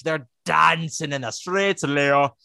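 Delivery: slow mock Irish-Scottish lilt on the line; Irish Scottish accent. Podcaster admits their accent comes out Irish Scottish. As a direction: try for Southern, land in Irish Scottish. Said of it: "I can only make it Irish Scottish".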